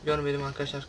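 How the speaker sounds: ordinary talk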